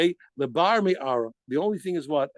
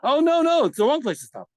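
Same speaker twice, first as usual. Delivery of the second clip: loud, high-pitched burst of dismay; sudden alarmed realization; 9 semitones higher, 6 dB louder than usual. Real, not acted. Teacher realizing he has ended the class too soon, alarmed. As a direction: catch your own mistake with a jolt, voice leaping up.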